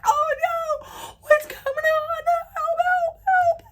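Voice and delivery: very high, squealing mock cries for help; imitated yelling. Imitating friend with top over her head, high laughing yells. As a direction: wail it while cracking up. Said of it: "she's yelling".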